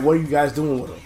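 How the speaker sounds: ordinary talk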